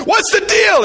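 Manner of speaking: high, loud mock demand; imitating a fed-up dad; exasperated shout. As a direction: throw it up loudly in frustration. Parents mad at each other.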